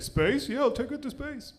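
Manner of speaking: lightly voiced smug fiancé promising space; mock rich guy. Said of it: "And the guy was like".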